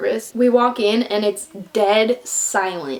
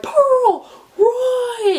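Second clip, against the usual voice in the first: panicked shout to Pearl. Retold or imitated. Warning friend during attack.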